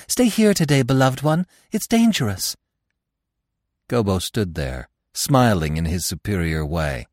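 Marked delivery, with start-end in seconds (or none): 0.1-2.6 much higher desperate plea; rising panic, beg him to stay; begging. A narrator's reading. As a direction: Marina begging her beloved to stay. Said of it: "Even Marina begged".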